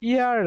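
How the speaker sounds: "ইয়ার" starting straight on the vowel, no H; silent H. Shown as a demonstration. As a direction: drop the H and open "ইয়ার" on an ee glide. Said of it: "হিয়ার না হয়ে ইয়ার". ("ইয়ার" এইচ ছাড়া, স্বরধ্বনি দিয়ে শুরু)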